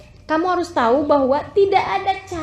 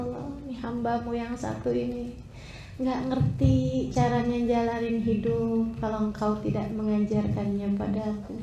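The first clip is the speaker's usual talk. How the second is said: pleading prayer; 5 semitones lower, 8 dB quieter than usual. Pretend. Preacher voicing a lost woman's prayer, soft and pleading. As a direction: beg quietly like a broken servant. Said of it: "Kamu berdoa manggil nama Allah".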